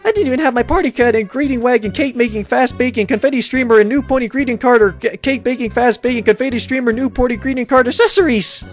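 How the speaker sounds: high, rushed, excited cartoon pony; Pinkie Pie. Pinkie with a newcomer to welcome, overexcited. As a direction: bubble over, high-pitched and tumbling through the list. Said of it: "a look of unbridled excitement and happiness".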